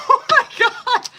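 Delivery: high laughing burst; laughing hard at herself. Real, not acted. Laughs at own indigestion.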